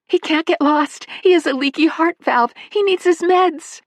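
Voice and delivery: high, anxious motherly voice; urgent pleading. Acted. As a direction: press her to find Rusty. Missus Manley pleads urgently.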